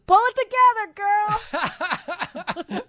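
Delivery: high, slow mocking heckle; sing-song call from the crowd; drawn-out mock heckle with a laugh; heckler girl. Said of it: "a girl in the audience goes".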